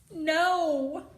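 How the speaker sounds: loud, high refusal; defiant no. Acted. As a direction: plant your feet and refuse. Girl refuses to leave.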